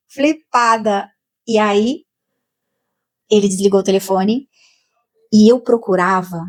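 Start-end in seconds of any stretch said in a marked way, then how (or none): 0.1-1.1 "flipada" stretched out very slowly, dazed. Real, not acted. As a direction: drag out "flipada" in awe. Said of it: "eu fiquei olhando assim pra ele".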